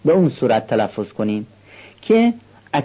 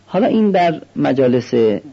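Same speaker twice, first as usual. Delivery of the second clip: ordinary delivery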